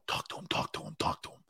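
whispered, urgent, repeating it; hushed urging. Retold or imitated. Staff quietly urging him to talk to the coach, whispered. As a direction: hiss it like staff nudging you forward.

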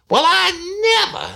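high, loud and slow outrage; outraged old lady. Retold or imitated. Woman on the phone threatening the rude caller, loud and outraged. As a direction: spit it out, scandalized.

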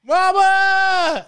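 second desperate scream for his mother, still very high and loud; repeated anguished yell. Retold or imitated. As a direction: hold the same raw, high wail. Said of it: "he just yells".

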